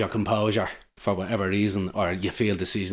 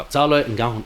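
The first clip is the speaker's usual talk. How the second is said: defiant exit; 4 semitones higher, 8 dB louder than usual. Retold or imitated. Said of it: "And I go".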